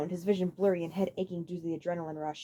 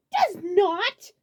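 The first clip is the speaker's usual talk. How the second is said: high, loud retort; angry denial; shout; 13 semitones higher, 8 dB louder than usual. A narrator's reading. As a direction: fire back indignantly. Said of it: "Roy shouted".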